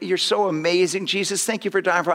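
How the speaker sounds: ordinary delivery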